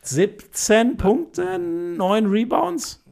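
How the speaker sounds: each stat stretched out very slowly, rising high; slow, high, lingering; slow drawn-out stats. Acted. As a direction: savour the numbers slowly. Drags out the stats.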